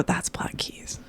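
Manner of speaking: hushed knowing whisper. Retold or imitated. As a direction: breathe it like a secret.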